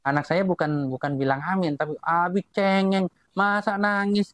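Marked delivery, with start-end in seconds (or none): 2.0-4.3 higher-pitched imitation of a complaining child; protesting child. Child scolds crying dad at the grave, imitated protesting child. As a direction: imitate a kid's indignant protest. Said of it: "anak saya protes tuh".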